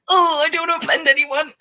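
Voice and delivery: high, fretful little wail. Real, not acted. Streamer worried about offending viewers, fretful wail.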